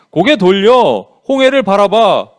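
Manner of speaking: loud, emphatic divine order; acted firm command. God commanding Moses in retold speech.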